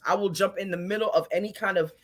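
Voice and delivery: ordinary talk, nothing marked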